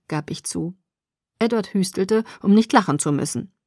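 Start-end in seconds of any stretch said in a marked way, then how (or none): none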